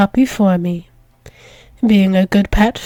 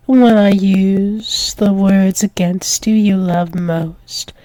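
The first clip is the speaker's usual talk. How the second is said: ordinary talk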